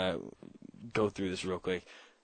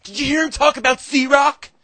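put-on high excited kid voice, much higher and louder; mock teen kid; 11 semitones higher, 7 dB louder than usual. Host imitating skate kids gossiping about his show. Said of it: "They're like".

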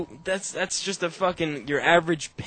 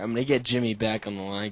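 ordinary talk

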